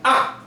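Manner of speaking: 아 falling sharply from high; fourth tone. Shown as a demonstration. Teacher showing the fourth tone, falling sharply. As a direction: drop 아 from high to low like a Mandarin fourth tone. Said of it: "위에서부터 아래로 내려와요".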